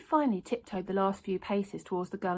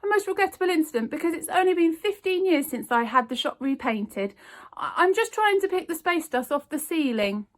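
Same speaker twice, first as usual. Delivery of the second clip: nervous fib; 8 semitones higher, 5 dB louder than usual. A narrator's reading. Raj lying nervously.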